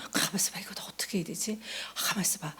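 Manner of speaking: soft, hushed pondering to herself; whispered self-talk. Retold or imitated. To herself under a trial, hushed whisper. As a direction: murmur it like a private thought.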